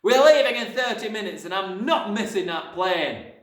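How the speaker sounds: hectoring dad laying down the law; angry shout. A narrator's reading. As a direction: bellow it like an impatient father. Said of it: "the father shouted".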